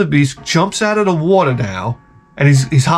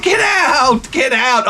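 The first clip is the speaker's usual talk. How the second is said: yelling at the screen; 8 semitones higher, slower than usual. Retold or imitated. Yelling at the wildebeest.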